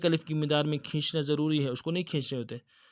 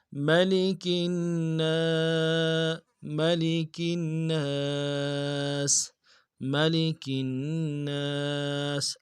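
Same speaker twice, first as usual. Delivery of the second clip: slow melodic tajweed recitation; Quran recitation; much slower than usual. Shown as a demonstration. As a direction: chant it slowly with full tajweed. (قرآن کی تلاوت، آہستہ اور سریلی)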